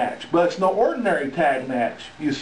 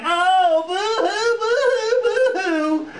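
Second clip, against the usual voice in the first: high, hammed-up fake sobbing with a laugh; mock boo-hoo crying; 14 semitones higher, slower than usual. Manager mocking the losers with fake boo-hoo sobs. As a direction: blubber it like a mocking crybaby. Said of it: "they're crying the blues now".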